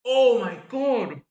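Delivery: loud, slow, high Oh my God; shocked outburst. Real, not acted. Streamer watching Leganés' chance: loud shocked cry. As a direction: caught off guard, crying out.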